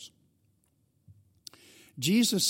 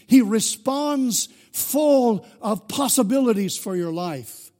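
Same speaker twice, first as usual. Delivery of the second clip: higher, louder, slow preaching; preaching at full force; 6 semitones higher, 6 dB louder, slower than usual. Acted. Pastor proclaims grace to the congregation, fervent preaching. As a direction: proclaim the good news, building.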